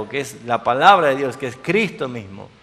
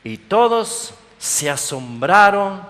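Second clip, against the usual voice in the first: ordinary talk, nothing marked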